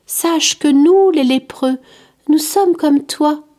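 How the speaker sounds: put-on a kind outcast reassuring a curious child; gentle leper. The leper tells the boy Curius why people flee, gently insisting.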